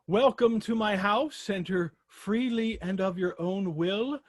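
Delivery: hammed-up vampire host welcome; Dracula welcome. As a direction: savour each word like the Count. Host's Dracula welcome quote.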